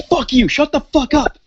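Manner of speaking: high, raging mock yell of "Fuck you, shut the fuck up"; woman yelling at the neighbour through the wall; angry yell. She yells at the neighbour.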